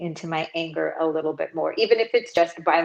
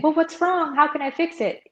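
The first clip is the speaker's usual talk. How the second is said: high, put-on concerned voice; mock eager fixer; 9 semitones higher than usual. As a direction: imitate someone over-eager to fix it. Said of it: "bless their hearts".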